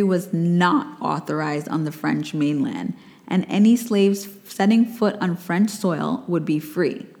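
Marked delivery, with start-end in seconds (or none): none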